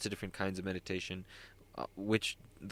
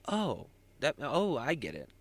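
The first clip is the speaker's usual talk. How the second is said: higher-pitched acted "oh, I get it"; lightly acted aha moment; dawning realization; 6 semitones higher than usual. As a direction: play the moment it suddenly clicks. Acting out sudden understanding in conflict.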